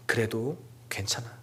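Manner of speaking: soft whispered comfort; gentle reassurance. Acted. Jesus comforting ashamed Peter, soft whisper. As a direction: whisper it to soothe him.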